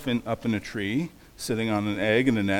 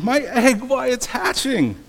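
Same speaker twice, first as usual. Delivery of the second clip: high, loud, amazed exclamation; excited shout; 11 semitones higher, 5 dB louder than usual. A narrator's reading. Horton shouts as his egg hatches, excited. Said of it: "shouted Horton".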